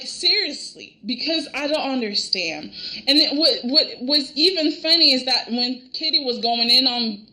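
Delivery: normal talking voice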